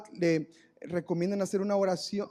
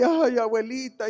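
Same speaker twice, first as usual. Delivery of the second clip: mock wailing; 6 semitones higher, 8 dB louder, slower than usual. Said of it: "toda la familia llore y llore".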